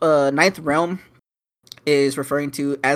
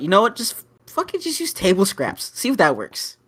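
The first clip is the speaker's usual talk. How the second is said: raised, higher-pitched mock outburst; retold yell; 6 semitones higher, 3 dB louder than usual. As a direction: blurt it out as an exasperated yell. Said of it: "I just yelled out".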